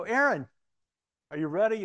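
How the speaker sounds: loud, high-pitched hail; calling out. Retold or imitated. Preacher re-enacts checking on the other new Sunday school teacher, calling out.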